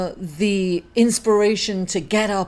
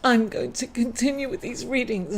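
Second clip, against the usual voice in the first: tearful; 4 semitones higher than usual. Real, not acted. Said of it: "I'm like an emotional wreck".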